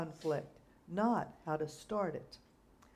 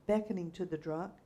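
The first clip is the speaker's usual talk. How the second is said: usual speaking voice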